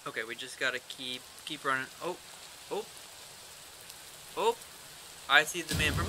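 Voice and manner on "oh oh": normal talking voice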